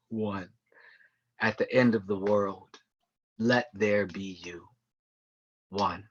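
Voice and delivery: slow, low, hushed close of a poem; poem recitation. Acted. Poet closing a love poem to a beloved, hushed recitation. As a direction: let each line sink, with long pauses. Poem's slow, soft ending.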